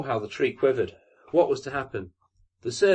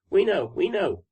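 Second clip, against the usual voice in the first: lightly voiced sparrows, higher and chirpy; chirping sparrows; 9 semitones higher, 4 dB louder than usual. A narrator's reading. Sparrows chirping answers up to the fir tree. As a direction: chirp it like eager little birds. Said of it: "chirped the sparrows".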